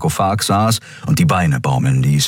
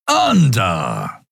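pleased growl. A narrator's reading. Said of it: "knurrte der Skria erfreut".

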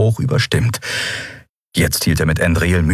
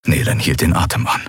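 usual speaking voice

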